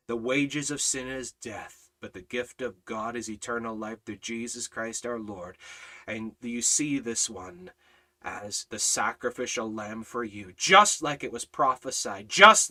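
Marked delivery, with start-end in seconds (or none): none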